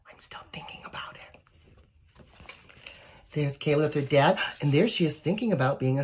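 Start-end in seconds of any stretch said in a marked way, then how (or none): 0.1-1.4 soft unvoiced whisper. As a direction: breathe the words like a secret. Whispering child opening.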